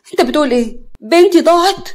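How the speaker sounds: high, loud disbelief, rising; shocked outcry. Acted. Mother hearing her daughter is lost, shocked outcry. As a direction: cry out in alarm, building to a shout.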